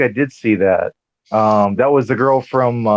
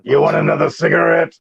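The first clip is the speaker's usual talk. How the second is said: raspy voice; 5 semitones higher than usual. Retold or imitated. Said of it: "with that really raspy voice".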